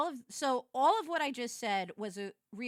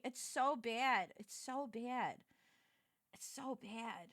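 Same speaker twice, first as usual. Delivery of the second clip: softer and softer, drawn out; spent, fading; 3 semitones lower, 9 dB quieter, slower than usual. Real, not acted. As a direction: let it fade away, drained after laughing. Winding down after laughing fit.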